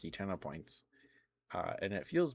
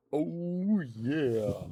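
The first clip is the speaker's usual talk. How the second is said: much higher, louder mascot bellow; hammed-up mascot catchphrase; Kool-Aid Man; 7 semitones higher, 4 dB louder than usual. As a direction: shout it like the jug smashing in. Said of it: "He would just go".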